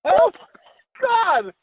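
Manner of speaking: a loud, high burst of disbelief; shocked, loud and high; shocked exclamation. Real, not acted. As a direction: blurt it out in real shock. Reacting to shocking story.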